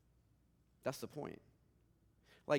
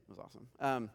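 normal talking voice

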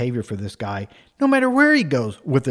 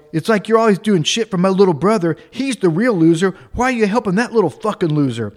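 loud, raised mock complaint; retold anger; 5 semitones higher, 5 dB louder than usual. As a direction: rail at God, acting out the indignation. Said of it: "He pivots his anger totally".

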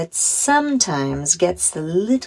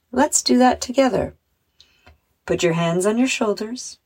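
measured meditation voice with long pauses; unhurried guided relaxation, phrase by phrase; 2 semitones lower than usual. Acted. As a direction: guide children gently through breathing and imagery. Leads a guided visualization.

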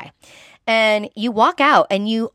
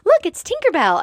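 higher excited parent pointing; parent to child; 12 semitones higher, 4 dB louder than usual. Retold or imitated. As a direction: point it out to a little kid, delighted. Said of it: "people talking to their kids".

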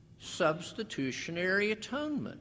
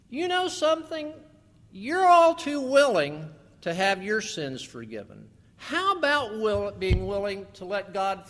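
forceful rebuke; 2 semitones higher, 6 dB louder than usual. Real, not acted. Preacher rebukes congregation.

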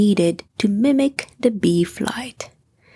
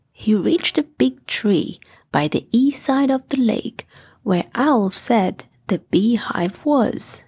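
ordinary delivery

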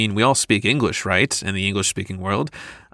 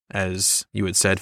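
usual speaking voice

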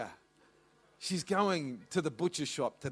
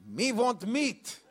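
halting broken English; 7 semitones higher, 6 dB louder, slower than usual. Retold or imitated. Mum answers the butcher.

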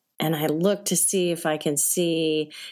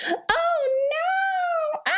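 high mock panicked wail; imitating panicked cries; much slower than usual. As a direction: mimic screaming passengers, much higher. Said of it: "You could hear people".

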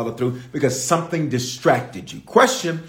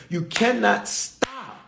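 slow plea; 8 semitones higher, 4 dB quieter, much slower than usual. Acted. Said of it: "preaching plea".

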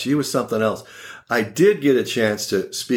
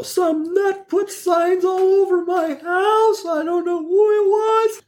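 put-on very high, slow old-lady voice; confused grandma; slower than usual. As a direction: squeak it out like a muddled old grandma.

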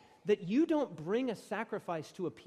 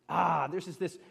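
ordinary delivery

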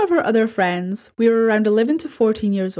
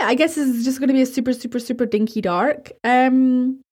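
plain reading voice

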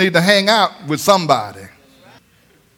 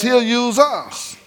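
slow emphasis; 5 semitones higher, much slower than usual. Acted. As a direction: say it slow and settled. Preacher repeats line.

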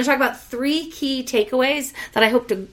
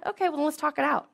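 ordinary talk

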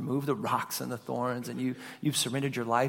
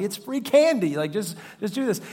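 high, loud, exasperated plea; begging a stubborn kid to just take the candy; father pleads with toddler over free candy, exasperated; exasperated pleading; 7 semitones higher, 5 dB louder than usual. Retold or imitated.

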